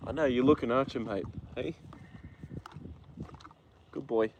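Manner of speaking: hushed, gentle coaxing of a nervous horse; soothing horse talk. Real, not acted. Owner softly soothing his horse Joda, hushed and gentle.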